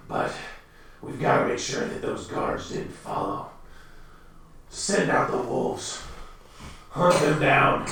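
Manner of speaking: werewolf leader quietly ordering a hunt. Acted. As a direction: hush out commands like a menacing boss. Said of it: "werewolf boss answers".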